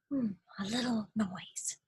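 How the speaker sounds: soft, hushed murmur; whisper. Acted. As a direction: murmur it under the breath. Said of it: "whispered the rabbit".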